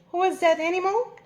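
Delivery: usual speaking voice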